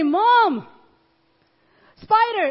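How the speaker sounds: put-on high, loud little child's call; high child's voice crying out; child calling mom. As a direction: call out like a small kid alarmed by a spider. Child calling mom about spider.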